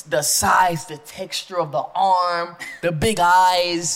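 drawn out slowly, word by word; slow and deliberate. Real, not acted. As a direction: savor each creepy detail slowly. Dwelling on creepy bug details.

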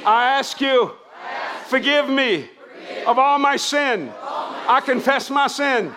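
loud, high, slow phrases for repeating; full-force salvation prayer. Real, not acted. Preacher leading the congregation in a sinner's prayer, shouting slowly. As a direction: shout each line and stretch its last word.

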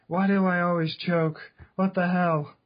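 usual speaking voice

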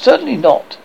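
scandalized mother; firm refusal. A narrator's reading. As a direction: put your foot down. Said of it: "Wendy was scandalized".

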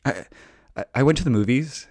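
flustered self caught off guard, fumbling out a lie; nervous stammering lie. Retold or imitated. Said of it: "So I lied and I was like".

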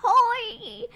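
put-on high, slow, quavery British granny accent; British grandma. Mom playing a British grandma offering tea. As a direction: put on a wobbly old British lady accent. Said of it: "She's British".

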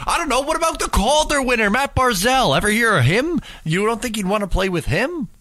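mocking Steve Simmons. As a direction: parody a pundit's smug rhetorical questions in a squeaky voice. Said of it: "Steve Simmons goes".

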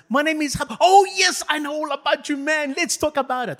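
eager welcome. Pretend. Pastor plays a well-read believer welcoming Habakkuk, high and eager. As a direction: greet him like an old friend.

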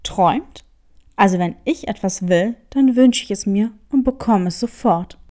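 low, fast, boastful tomcat; bold cat. Acted. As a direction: brag it off like a cocky cat. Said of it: "behauptete der Kater kühn". (kühner, schneller, tiefer Kater)

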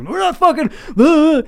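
put-on young guy shouting at shady drivers to get lost; retold freak-out shout. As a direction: blow up at the strangers in the car. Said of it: "I was like".

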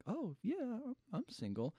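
a hint of coy flirting; mock flustered reply. Retelling her coy answer to the handsome man. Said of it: "And she's like".

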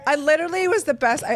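ordinary talk, nothing marked

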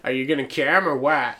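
bad Boston accent, hammed up; lightly put-on Boston accent. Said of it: "that's a really bad Boston".

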